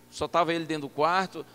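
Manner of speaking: ordinary talk, nothing marked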